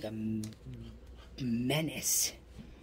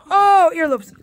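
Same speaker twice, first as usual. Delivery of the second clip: high, loud yelp; startled exclamation; 9 semitones higher, 12 dB louder than usual. Real, not acted. Owner nibbled by a bulldog puppy, high startled yelp. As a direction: yelp as the puppy gets your earlobes. Puppy going for her earlobes.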